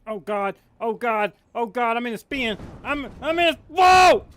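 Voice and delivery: panicked yelling while spinning; real panic, voice climbing to a shout; terrified gibbering. Real, not acted. Crashing spinning aircraft, panicking.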